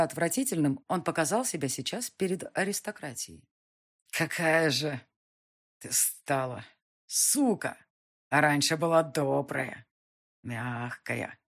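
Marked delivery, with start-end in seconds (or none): 4.1-11.4 put-on slurred, drowsy drunken mutter; sleepy drunk muttering. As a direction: mutter it while dozing off drunk. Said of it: "пробормотал он, засыпая".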